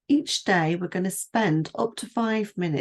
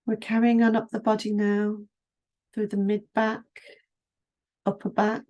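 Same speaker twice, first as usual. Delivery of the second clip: guided meditation; 2 semitones higher than usual. Acted. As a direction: ease the listener down through the body, unhurried. Leads a standing body scan.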